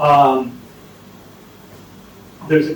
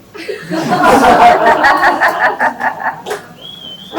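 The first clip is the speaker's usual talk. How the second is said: high, loud cackling laugh; cracking up at his own punchline; big laugh; 9 dB louder than usual. Real, not acted. Teller bursts out laughing after the punchline.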